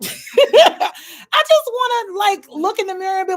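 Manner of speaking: high-pitched, giggly delivery; laughing. Real, not acted. Picturing herself at the mirror after surgery, laughing. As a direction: talk through a burst of laughter.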